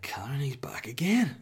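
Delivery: slow urgent whisper; alarmed whisper. Retold or imitated. Whispering to his wife that the mouse is back.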